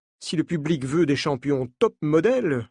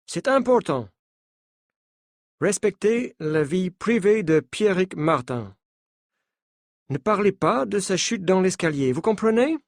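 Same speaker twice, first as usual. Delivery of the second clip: put-on higher French with a light American accent, firm; 4 semitones higher than usual. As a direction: warn them firmly in an American accent. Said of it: "dit-il avec un accent américain".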